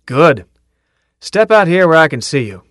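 loud order to step forward; sergeant's command. Acted. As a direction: call him out of the ranks.

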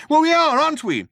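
very high, loud, frantic comeback; high-pitched hysterical protest; panicked retort. A narrator's reading. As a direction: insist on it in panic. Panicked man insists they will die.

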